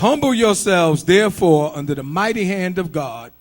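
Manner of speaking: loud first lines, easing off at the end; emphatic scripture echo; preacher echoing scripture. Acted. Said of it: "echoes the reading".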